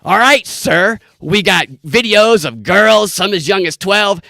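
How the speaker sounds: sleazy pawn shop guy. Retold or imitated. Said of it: "the guy goes".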